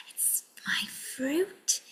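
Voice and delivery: slow soft whisper. Pretend. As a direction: whisper it secretly. Puppet whispers its favourite fruit.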